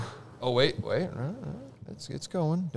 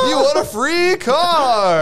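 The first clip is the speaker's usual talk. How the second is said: slow, high prize reveal; game show host; 9 dB louder, much slower than usual. Pretend. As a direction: stretch it out like a TV host.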